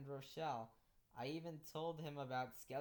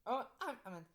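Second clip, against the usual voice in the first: high, loud apology; apologetic; 10 semitones higher, 9 dB louder than usual. Acted. Teacher bumps into a ghost girl, hurried apology. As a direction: apologize quickly, pitched up. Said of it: "I apologize".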